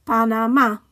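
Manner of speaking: Panamá with the final MÁ stressed; stress on accented syllable. Shown as a demonstration. Teacher modelling written accents, Panamá stressed at the end. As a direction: say Panamá in Spanish, pa-na-MÁ. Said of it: "stress the syllable that the accent is on".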